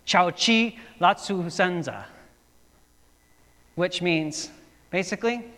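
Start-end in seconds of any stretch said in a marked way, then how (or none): 0.0-2.0 loud, higher mock Chinese call, slowing at the end. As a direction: bark the order in pretend Chinese. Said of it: "he speaks to him in, I believe, Chinese".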